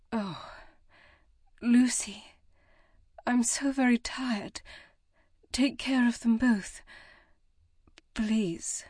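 faint, fading, whispered pleas; exhausted whisper. A narrator's reading. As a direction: let the strength drain out as she begs. Dying mother fading into exhaustion.

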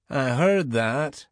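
slow, lilting Scottish voice; Scottish accent. A narrator's reading. Commander overhears news of the shuttle, slow Scottish accent. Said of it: "The man had a distinctive Scottish accent".

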